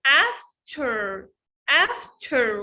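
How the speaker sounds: "after" slowly split, af-ter, with a crisp t; "after" in two slow beats with an aspirated t. Shown as a demonstration. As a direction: break "after" in two and puff the t. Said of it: "وبنعطش التي هنا".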